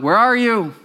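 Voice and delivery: loud, high call across the garden. Acted. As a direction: call out searching for them. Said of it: "God calls out".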